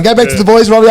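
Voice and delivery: loud, high, insistent order; urgent brush-off. Retold or imitated. Radio host Steve hurrying Robbie off air, insistent. Host cuts him off again.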